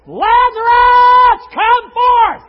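high shout, the name stretched long; crying out. Acted. Jesus calls one man from the grave, crying out. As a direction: summon him by name at full voice. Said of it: "But he didn't cry ... He was specific".

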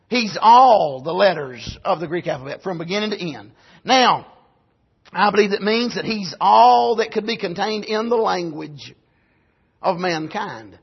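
normal talking voice

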